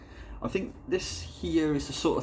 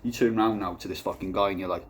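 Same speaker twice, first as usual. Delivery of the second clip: usual speaking voice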